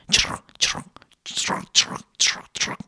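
soft whispered gravel crunch; whispered crunch sound. A narrator's reading. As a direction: mimic hooves on gravel.

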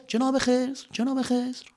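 fading cave echo. Acted. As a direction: let the name echo away.

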